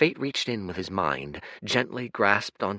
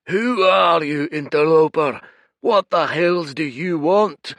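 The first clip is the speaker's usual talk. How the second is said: booming creature; 9 semitones higher, 10 dB louder than usual. Acted. Red giant bellowing at the rowing stranger, furious roar. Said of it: "the creature boomed".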